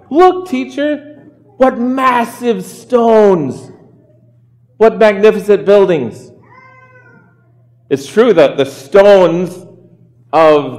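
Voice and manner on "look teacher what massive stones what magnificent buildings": slow, high, drawn-out wonder; acted awe, lingering on each word; amazed. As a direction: marvel aloud as an awestruck disciple. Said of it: "his disciples are amazed".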